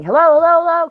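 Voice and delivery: high loud calls of hello; calling out. Retold or imitated. Calling to a girl at the gate, scared and high. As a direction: call out to the passer-by, scared. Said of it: "and I was yelling".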